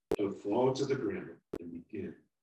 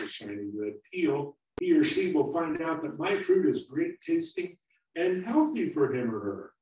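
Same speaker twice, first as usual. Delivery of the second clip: little apple, eager; 5 semitones higher, 7 dB louder than usual. Acted. Little apple keeps talking.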